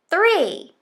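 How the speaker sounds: high, loud final count; excited countdown. Acted. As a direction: land on three with a burst. Counting down to the bite.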